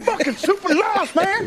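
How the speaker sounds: high laughing blurt through giggles. Real, not acted. Co-host laughing at Rodgers getting pulled, laughing. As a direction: crack up while you say it.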